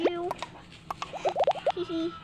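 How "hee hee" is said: ordinary talk, nothing marked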